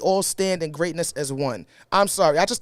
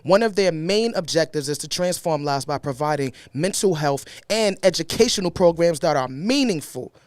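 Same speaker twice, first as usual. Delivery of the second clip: ordinary talk, nothing marked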